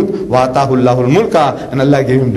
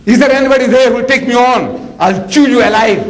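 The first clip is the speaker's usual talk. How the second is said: giant shouting a challenge; 9 semitones higher than usual. Retold or imitated. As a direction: bellow the giant's challenge across the valley. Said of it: "this Jalut is shouting".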